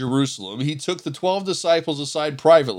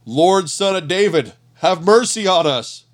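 raised voice calling out for mercy; shouting; 5 semitones higher, 6 dB louder than usual. A narrator's reading. Blind men begging Jesus from the roadside, raised voice. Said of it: "they began shouting".

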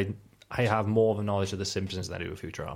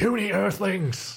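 high, slow booming alien; giant alien booming voice; 11 semitones higher, much slower than usual. Acted. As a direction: boom it out like an alien ruler. Lrrr quote, booming.

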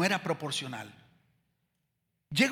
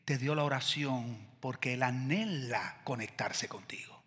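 whisper; 8 semitones lower, 8 dB quieter than usual. Real, not acted. Tender point in sermon.

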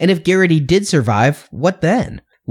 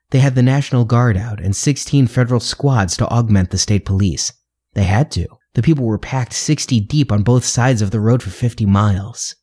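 lower, steady audiobook narration; reading a story aloud; 6 semitones lower than usual. A narrator's reading. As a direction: read the passage like an audiobook. Reads a long novel passage aloud.

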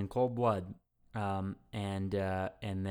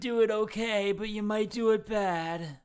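teasing hedge; 10 semitones higher than usual. Acted. Host warns co-host about attempting the accent, high-pitched teasing hedge.

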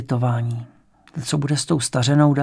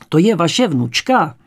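clear questioning; 3 semitones higher, 7 dB louder, slower than usual. A narrator's reading. Questioning a confused old woman.

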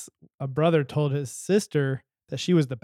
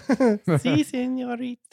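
mock Spanish accent; 9 semitones higher, slower than usual. Jokey Spanish reply.

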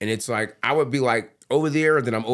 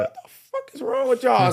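exasperated; 11 semitones higher than usual. Retold or imitated. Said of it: "I'm like".

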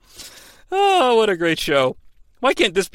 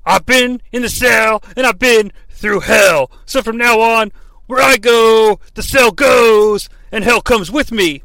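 higher, louder, slowed promo shout; Scott Steiner; 5 semitones higher, 6 dB louder than usual. Retold or imitated. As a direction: roar it like a wrestler cutting a promo. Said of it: "Steiner indicates".

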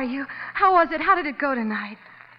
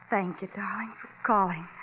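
barely voiced, lower, a breath of thanks at the end; whispered thanks; 3 semitones lower, 7 dB quieter than usual. Wife thanking her husband on the phone, whispering. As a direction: let the words fall away into a whisper.

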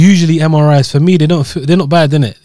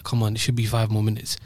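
inner voice; 5 semitones lower, 12 dB quieter than usual. Retold or imitated. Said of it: "counting it down in my head".

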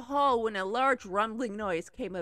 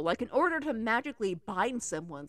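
usual speaking voice

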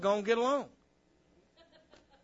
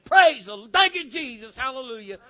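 loud burst of worship, easing off; exuberant praise; 6 semitones higher, 9 dB louder than usual. Acted. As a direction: cry out like you just arrived on fire. Acts out excited arrival at church.

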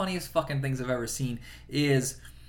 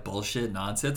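ordinary talk, nothing marked

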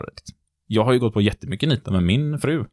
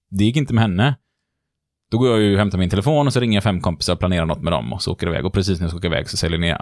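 ordinary delivery

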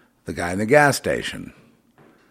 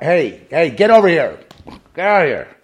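loud, high, urgent shouts; shouting; 9 semitones higher, 9 dB louder than usual. Real, not acted. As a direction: yell at them to get away. Shouting someone away while hurt.

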